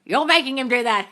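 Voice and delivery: high, loud and gleeful; gleeful cheer. Real, not acted. Coach cheering on a follower's success, gleeful cheer. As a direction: crow it triumphantly, about to laugh.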